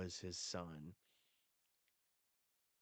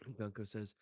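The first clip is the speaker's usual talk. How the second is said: ordinary talk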